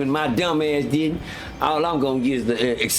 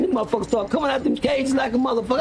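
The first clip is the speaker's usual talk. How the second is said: ordinary talk, nothing marked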